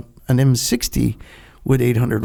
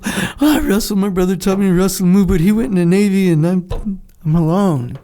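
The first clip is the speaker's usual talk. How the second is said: put-on young eager kid; 4 semitones higher, 3 dB louder than usual. Younger self answering coach.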